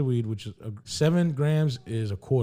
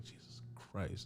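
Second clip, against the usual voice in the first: slow, near-whispered retold reaction; hushed dismay; 4 semitones lower, 19 dB quieter, slower than usual. Reacting to a big bag of coke, whispered dismay. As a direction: breathe it out slowly under your breath.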